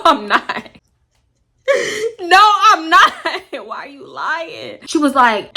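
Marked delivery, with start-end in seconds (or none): 2.2-3.1 indignant denial. Retold or imitated. Indignant denial of being friend.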